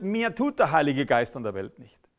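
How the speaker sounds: usual speaking voice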